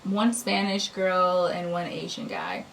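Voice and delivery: usual speaking voice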